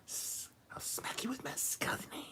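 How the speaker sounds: high eerie whisper, threatening skeleton; creepy whisper. Acted. Skeleton hands bit, creepy whisper.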